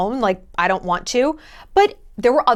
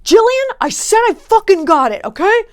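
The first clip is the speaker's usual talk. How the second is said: higher, louder frustrated yell; angry dad; 9 semitones higher, 6 dB louder than usual. Retold or imitated. Dad snapping at Jillian in the subway, loud frustrated yell. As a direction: snap like a dad losing it on the subway. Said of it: "it was always the dad".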